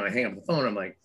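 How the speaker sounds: ordinary talk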